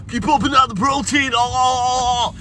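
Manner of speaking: high, strained action-hero impersonation; terrible Arnold Schwarzenegger. Acted. Streamer signs off to William, hammed-up action-hero impersonation. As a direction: do a bad action star, drawing out the cry. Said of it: "that's a terrible Arnold Schwarzenegger".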